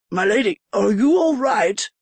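put-on concerned old servant voice; worried old man asking. A narrator's reading.

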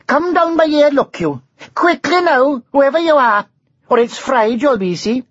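high sing-song Welsh dragon voice, threatening; lilting Welsh dragon. A narrator's reading. Dragon orders the hidden knight down, high Welsh lilt. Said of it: "a lilting sing-song voice, high-pitched and with an unfamiliar accent".

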